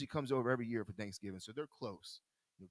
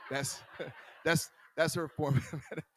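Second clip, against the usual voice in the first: laughing; 2 semitones higher, 5 dB louder than usual. Real, not acted. Laughing at wife's nap trick.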